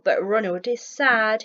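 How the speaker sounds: ordinary delivery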